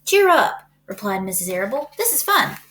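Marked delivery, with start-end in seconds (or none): 0.0-0.6 bright, much higher and louder; cheerful. A narrator's reading. Cheerful reply to frightened friend. 2.0-2.6 high, bright and delighted; cheerful. A narrator's reading. Cheerful reply to frightened friend.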